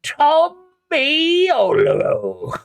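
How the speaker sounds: surprised, drawn-out well well well; calling out a greeting. Acted. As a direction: greet an old acquaintance with mock delight. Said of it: "You hear this voice sort of call out".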